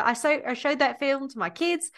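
broad mock Australian accent. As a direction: overdo the Australian like Crocodile Dundee. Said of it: "Crocodile Dundee the fuck out of that".

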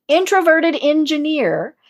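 normal talking voice

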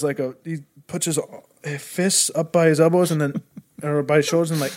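ordinary delivery

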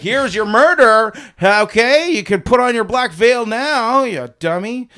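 high, loud scornful shouting; mock furious yell. Husband yelling at his wife about the murder. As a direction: yell it at her with contempt.

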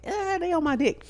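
squeaky high mock brag; high-pitched smug aside. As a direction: toss it off in a very high joking voice. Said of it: "I be like".

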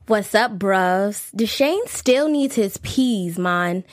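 light London roadman voice; a hint of a London accent; mock London accent. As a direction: play a London lad in an ad. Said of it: "ad in a London voice".

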